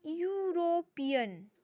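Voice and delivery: यूरोपियन slow and drawn out with a य start; sounding out European. Shown as a demonstration. Teacher sounding out the next word. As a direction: stretch out यूरोपियन. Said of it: "अब देखिए".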